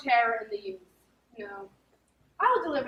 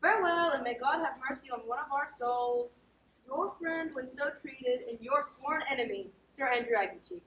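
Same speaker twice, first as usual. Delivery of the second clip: ordinary delivery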